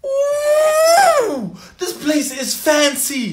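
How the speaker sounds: high, awed ooh; impressed ooh. Acted. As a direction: marvel at the fancy room.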